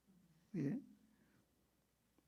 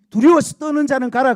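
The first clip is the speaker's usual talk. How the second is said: loud, raised order to the fearful; booming command; 6 semitones higher, 16 dB louder than usual. Retold or imitated. Preacher voicing God sending the fearful home, booming. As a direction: proclaim it like a decree.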